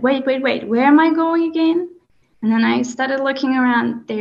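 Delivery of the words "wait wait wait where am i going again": sudden high-pitched retold alarm; mildly reenacted surprise, voice jumping up; startled realization. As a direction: catch yourself mid-trance, caught off guard. Suddenly I woke up and said.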